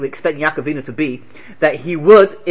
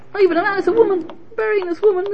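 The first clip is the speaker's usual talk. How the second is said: astonished; 14 semitones higher, faster than usual. Real, not acted. Surprised emphasis.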